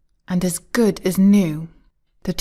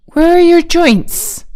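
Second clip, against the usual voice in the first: high, loud anxious question; 9 semitones higher, 6 dB louder than usual. A narrator's reading. Dorothy returns with the oil can, asking anxiously. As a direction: ask it urgently, pitch right up. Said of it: "she returned and asked anxiously".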